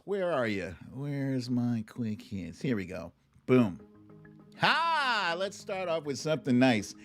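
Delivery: normal talking voice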